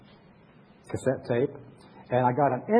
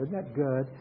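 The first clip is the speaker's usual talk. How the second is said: normal talking voice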